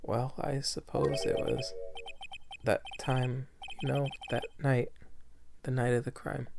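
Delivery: timid witness voice. Acted.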